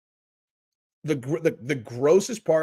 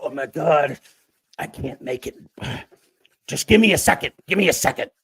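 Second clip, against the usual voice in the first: mock exhausted man, building to pleading; struggling old creep. Creep who can't make it asks for a second, mock struggling.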